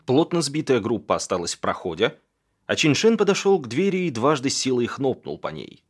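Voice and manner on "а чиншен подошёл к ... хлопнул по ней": plain reading voice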